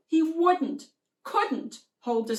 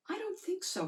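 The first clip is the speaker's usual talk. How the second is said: soft, higher, timid no; quiet disagreement; 13 semitones higher, 7 dB quieter, faster than usual. Acted. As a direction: quietly push back on your sister.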